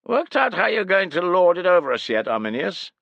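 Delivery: high, loud nasal jeer. Acted. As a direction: sneer it through the nose, high and loud. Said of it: "whose nasal voice carried over the clamour".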